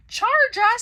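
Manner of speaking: very high, very loud outraged scream. Acted. Waffle screaming at the professor over her price. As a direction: explode in outrage. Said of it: "screamed Waffle".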